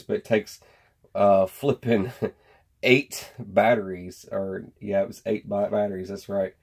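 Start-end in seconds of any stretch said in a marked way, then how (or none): none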